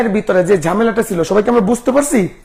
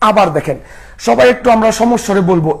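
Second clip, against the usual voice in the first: ordinary delivery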